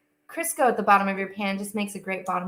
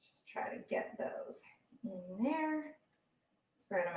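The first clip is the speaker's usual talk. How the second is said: half-whispered to herself; 14 dB quieter than usual. Real, not acted. Muttering to herself while spooning peaches, whispered. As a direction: breathe it under your breath while concentrating. Quietly working while talking.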